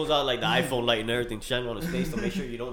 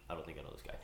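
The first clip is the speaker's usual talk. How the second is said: hushed puzzled; 12 semitones lower, 21 dB quieter, faster than usual. Retold or imitated. Kevin staring at sleeper.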